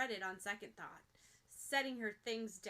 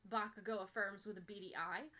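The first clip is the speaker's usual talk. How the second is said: usual speaking voice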